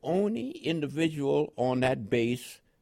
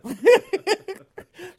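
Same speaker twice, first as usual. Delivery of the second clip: a loud, high burst of laughter; real hearty laugh; 8 dB louder than usual. Real, not acted. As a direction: laugh at the idea. Laughing at the Southern contrast.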